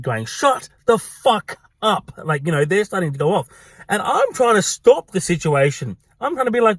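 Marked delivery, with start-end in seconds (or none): 0.3-2.1 slow, high-pitched irate order. Retold or imitated. Husband to his wife at the pub, irate. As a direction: imitate a husband at the end of his patience. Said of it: "her husband is getting more irate".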